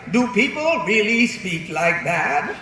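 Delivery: slow, mannered teacher, climbing in pitch; theatrical old-Hollywood diction; Katharine Hepburn. Acted. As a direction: imitate a baffled grande dame, drawing out each word. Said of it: "Katharine Hepburn".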